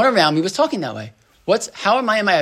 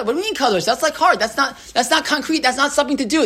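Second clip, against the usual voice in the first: higher, mock-puzzled pushback; skeptical objection; 8 semitones higher than usual. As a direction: play the doubting student, a bit higher. Voicing a skeptical listener's objection.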